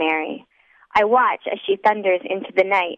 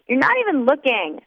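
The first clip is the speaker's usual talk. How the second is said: put-on drunk pout; 6 semitones higher than usual. A narrator's reading. Drunk narrator pouts.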